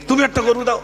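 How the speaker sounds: high imitated angry demand; mock the man's irritation; imitating the annoyed villager. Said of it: "তাই নিজে রেগে গেল".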